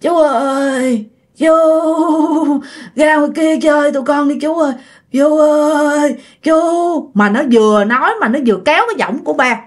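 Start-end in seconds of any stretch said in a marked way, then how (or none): 0.0-6.6 put-on eerie drawling ghost-boy voice; creepy slurred ghost. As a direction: stretch every word like sticky ghostly goo. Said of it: "Cái giọng của nó càng chậm, rồi trầm xuống mà rề".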